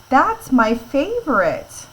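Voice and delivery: excited read of a favorite food. Shown as a demonstration. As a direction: read it like your favorite thing. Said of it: "Does someone sound excited?".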